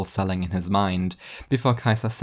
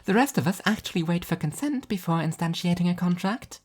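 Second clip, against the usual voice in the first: higher, prim correction; prim angel voice; 9 semitones higher than usual. Acted. Angel explaining consent to Martin, high and prim. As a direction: set the record straight sweetly.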